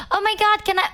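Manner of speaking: high, gasping fan excitement; a light imitation of a thrilled fan; excited fan. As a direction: squeal at the star like a starstruck fan.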